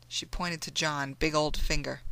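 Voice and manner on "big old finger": plain reading voice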